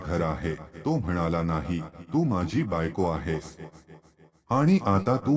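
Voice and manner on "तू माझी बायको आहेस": plain reading voice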